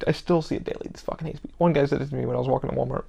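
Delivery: usual speaking voice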